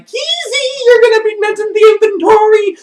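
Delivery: very high squealed protest; alarmed protest. Retold or imitated. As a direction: squeal it as if being manhandled. His protest while being searched.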